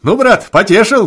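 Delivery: put-on high, loud drunken cry; shouting praise. A narrator's reading. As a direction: holler it with delight. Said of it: "кричал обалдуй".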